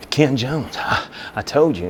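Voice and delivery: acted struggle for air; demonstrated breathlessness. Shown as a demonstration. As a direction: show how winded you were, puffing out the name. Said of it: "I want you to go like".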